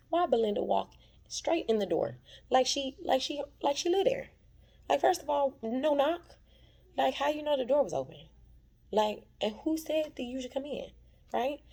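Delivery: high, loud indignant disbelief; how dare she walk in; outraged. Real, not acted. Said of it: "outraged reaction to Belinda".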